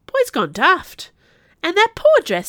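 high, loud, scandalised market woman; gossiping onlooker. A narrator's reading. Rose seller's friend lamenting the dress, scandalised. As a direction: exclaim it with indignant pity.

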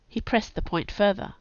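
ordinary delivery, nothing marked

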